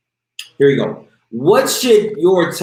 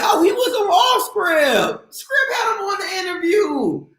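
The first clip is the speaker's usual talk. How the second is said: very high, hyped-up imagined fan; excited fan. Acted. Imagining listeners once the player blows up, excited fan. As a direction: squeal it like an amazed fan. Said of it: "you be like".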